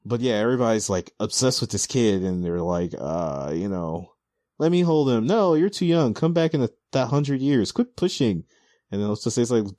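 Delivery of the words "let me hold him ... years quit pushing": higher, mock squabble over the baby; squabbling crowd. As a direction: act out people jostling for a turn. Crowd jostles to hold the baby.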